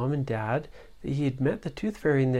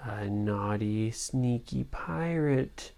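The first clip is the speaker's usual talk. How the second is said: hushed and sneaky; 3 semitones lower, 7 dB quieter, slower than usual. A narrator's reading. Narrator hushes for villain.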